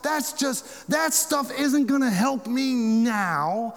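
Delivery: a high, strained voice of a doubting man. Acted. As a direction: whine it out like a man losing hope. Tom's doubting reply, acted out.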